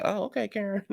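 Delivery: a teasing eye-roll of a reply; mock sarcastic. Recapper answering Karen's question, high sarcastic mockery. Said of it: "I was like".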